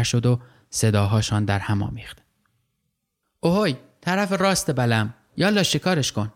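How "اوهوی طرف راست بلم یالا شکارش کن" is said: high, excited shout across the water; shouting kids. Acted. Kids calling from boat to boat, excited shout. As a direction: call out to the boat on the right. Children shouting during the catch.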